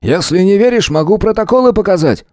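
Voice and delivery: high-pitched, irritated insistence; barked. A narrator's reading. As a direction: keep snapping, without shouting. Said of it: "рявкнул он". (раздражённо, с напором)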